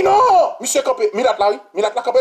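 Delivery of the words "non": mock outraged minister. Minister voiced in retold quarrel.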